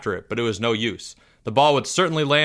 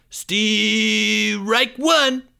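screamed call; 9 semitones higher than usual. Acted. Kid screaming the strike call to the class. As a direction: yell it out for the whole field. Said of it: "screamed Greg".

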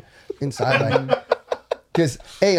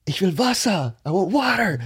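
aggressive demand; 8 semitones higher than usual. Retold or imitated. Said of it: "I was aggressive about it".

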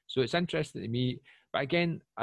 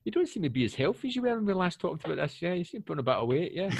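mock coach; 7 semitones higher, 5 dB louder than usual. Playing coach criticising fitness.

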